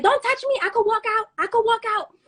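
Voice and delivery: high-pitched mock indignation; indignant protest. Storyteller replaying resisting being escorted out, indignant protest. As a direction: re-enact protesting while being hauled out.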